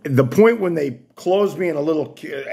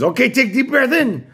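bossy technician barking an order; high imitation of technician; 11 semitones higher than usual. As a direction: mimic the technician's commands. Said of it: "And then she says".

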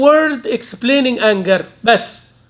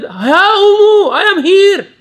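high, loud call matching the caller's tone; answering in the same tone; 8 semitones higher, 5 dB louder, slower than usual. Retold or imitated. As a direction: shout back in kind. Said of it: "replied in the same tone".

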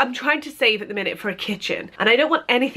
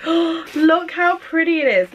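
excited; 7 semitones higher, slower than usual. Real, not acted. Admiring the new teapot.

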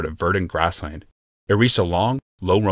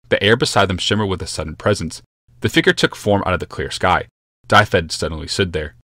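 plain reading voice

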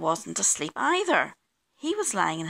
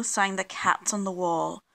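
usual speaking voice

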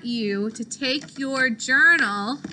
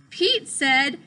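ordinary delivery, nothing marked

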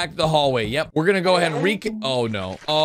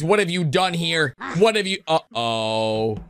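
usual speaking voice

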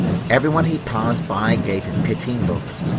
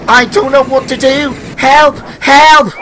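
high, very loud panicked cries; screaming panic; 11 dB louder than usual. Acted. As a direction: yell for help in full panic. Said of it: "he screamed".

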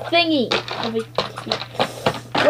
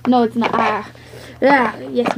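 ordinary delivery

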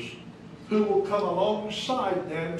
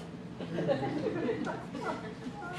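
amused laugh; 14 semitones higher, 7 dB quieter than usual. Real, not acted. Laughs at own high voice.

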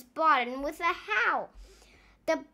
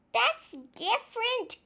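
Nong's voice, pitched higher; a higher, excited little cry; 8 semitones higher than usual. A narrator's reading. Nong noticing the monkey, higher cry. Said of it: "cried Nong".

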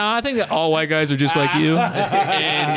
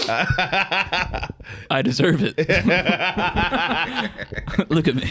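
laughing mock boast; 4 semitones higher, much slower than usual. Joking about rich name.

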